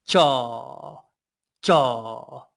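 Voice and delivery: jaw with the tongue floor curled down, dark vowel; jaw, dark and throaty. Shown as a demonstration. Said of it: "feel the back of the floor of your mouth curl down".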